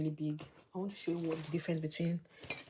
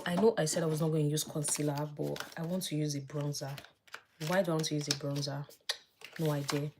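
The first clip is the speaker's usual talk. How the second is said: ordinary talk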